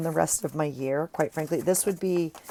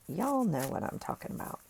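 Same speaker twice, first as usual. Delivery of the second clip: put-on southern accent; 4 semitones higher, 7 dB quieter than usual. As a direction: toss it off in a playful Southern accent. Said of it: "That was my southern".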